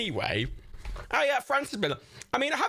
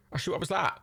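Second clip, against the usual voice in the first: usual speaking voice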